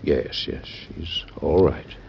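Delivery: soft, slow whispered reassurance; calm him down under your breath; hushed reassurance. Acted. Doctor soothing dying president.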